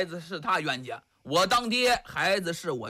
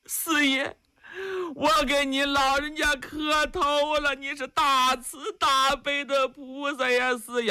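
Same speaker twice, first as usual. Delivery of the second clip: higher, tearful-toned woman's voice begging; kneeling mother's plea; 7 semitones higher than usual. Acted. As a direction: plead from her knees, voice lifted high. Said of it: "往前跪爬几步".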